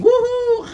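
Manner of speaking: very high, loud whoop; whooping yell. Retold or imitated. Cheney flying the planes by remote control, whooping loudly. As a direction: let out a wild celebratory whoop. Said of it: "and he's yelling".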